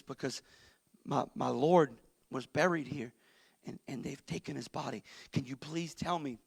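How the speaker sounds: soft, low, broken plea; a grieving woman hushed and pleading; tearful pleading. Acted. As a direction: beg quietly through tears. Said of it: "she's weeping in the garden".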